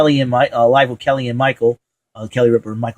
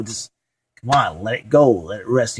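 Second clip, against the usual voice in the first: ordinary talk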